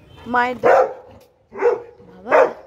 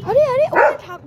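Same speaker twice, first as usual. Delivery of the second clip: much higher and louder, urgent warning; alarmed call; 10 semitones higher, 7 dB louder than usual. Real, not acted. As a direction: call out sharply to stop the dog. Warning dog away from cactus. (আতঙ্কে চেঁচিয়ে সতর্ক করা)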